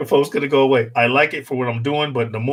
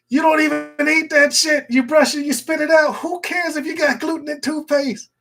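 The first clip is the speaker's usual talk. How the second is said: high, pitched-up comic rant; excited rant; 12 semitones higher than usual. Real, not acted. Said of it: "ranting at the absurdity".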